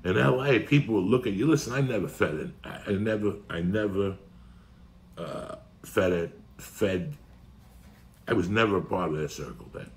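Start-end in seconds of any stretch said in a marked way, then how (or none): 1.5-7.1 halting, repeated stuttering start. Real, not acted. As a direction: stumble over the words softly. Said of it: "He just did a stuttering John".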